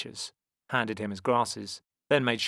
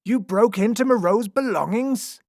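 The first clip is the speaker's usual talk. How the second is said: high, loud, aghast question; outraged cry; 13 semitones higher, 9 dB louder than usual. A narrator's reading. Lion tamer sees the broken trunk, crying out aghast. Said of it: "cried the lion tamer".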